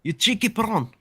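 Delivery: put-on South African accent, pitched high; quick District nine South African impression. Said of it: "I can't do a South African accent".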